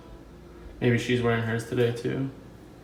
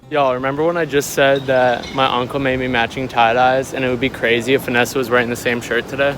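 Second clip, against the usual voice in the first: loud, fast excited rush; 2 semitones higher, 8 dB louder than usual. Real, not acted. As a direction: burst out with delighted disbelief. Excited reveal of matching shirts.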